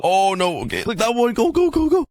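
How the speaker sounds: high frantic go go go. Real, not acted. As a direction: hurry it along in a panic. Frantic lighting the beacon.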